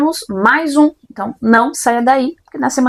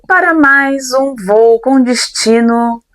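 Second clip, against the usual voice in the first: slow, formal cabin announcement; flight attendant announcement; much slower than usual. Acted. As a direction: announce it like cabin crew over the speaker. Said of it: "veio motivada ela hoje como comissária de bordo".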